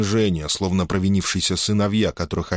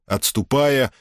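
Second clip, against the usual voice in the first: usual speaking voice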